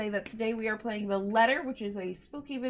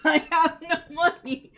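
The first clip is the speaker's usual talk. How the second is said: giggling that you're broke; laughing; 8 semitones higher, 6 dB louder than usual. Real, not acted. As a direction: laugh through a joking complaint. Joking about bit emotes.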